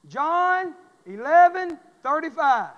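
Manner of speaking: loud, slow, each word stretched out; call to repeat. Shown as a demonstration. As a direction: drag it out so the room says it back. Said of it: "Say that with me".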